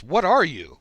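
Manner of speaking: startled demand. Acted. Other slug confronts the unmasked stranger, startled demand. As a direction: demand it, pitch swooping up and down.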